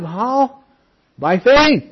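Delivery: "how" pitched high, "By faith" called out loud; emphatic preaching. Acted. The preacher answers his own question loudly to the congregation. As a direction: shout out the answer. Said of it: "Notice he's the object".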